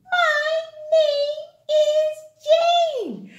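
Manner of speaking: put-on little girl saying her name slowly; high, drawn-out child voice. Teacher voicing child Jane.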